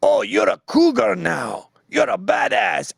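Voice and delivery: slow, high, hyped-up praise; hammed-up compliment to an older woman; mock flattery. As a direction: oversell it like a smarmy guy. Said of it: "you go".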